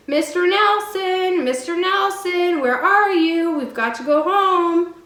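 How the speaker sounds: high, loud, slow calling shriek. A narrator's reading. Pippi calling her monkey from the woods. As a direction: call across the woods at full voice. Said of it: "shrieking at the top of her voice".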